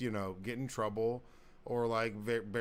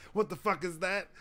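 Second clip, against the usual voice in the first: high mock yell, louder on the repeat; high-pitched retold outburst that grows louder; imitated yelling dad; 11 semitones higher, 6 dB louder than usual. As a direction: imitate an outraged dad, building. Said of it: "Dutch just starts yelling at both of us".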